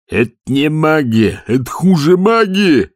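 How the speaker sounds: high, loud, slow terrified wail; panicked yell. A narrator's reading. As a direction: howl it out in horror. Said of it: "завопил тот". (вопль в ужасе, громко и протяжно)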